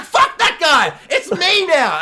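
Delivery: high, loud, laughing cocky boast; sassy triumphant Gwen. Retold or imitated. Gwen replacing Tobey Maguire, high cocky laughing boast. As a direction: brag like the new hero. Emma Stone voiced, triumphant.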